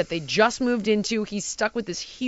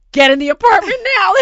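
much higher, much louder command; urgent order; 10 semitones higher, 12 dB louder than usual. Retold or imitated. Ordering her brother off the street, a much louder yell.

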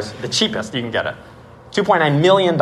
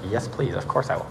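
meek acceptance; 2 semitones lower, 9 dB quieter than usual. Retold or imitated. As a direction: accept under your breath, awestruck. Retold awed acceptance of offer.

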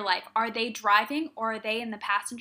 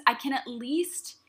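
ordinary talk

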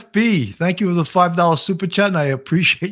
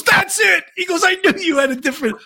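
the teacher, a little higher and fast; the teacher gets it right, excited and hurried; excited recognition; 13 semitones higher than usual. Retold or imitated.

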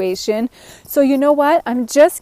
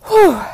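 puffed out whew after a long sentence; breathless whew; 9 semitones higher, 4 dB louder than usual. Real, not acted. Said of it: "I'm out of breath".

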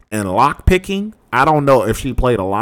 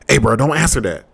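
normal talking voice

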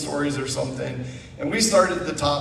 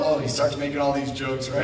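ordinary delivery

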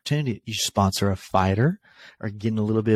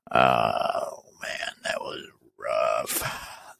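pained groan; 11 semitones lower, 4 dB quieter, much slower than usual. Real, not acted. Reacting to brutal hit.

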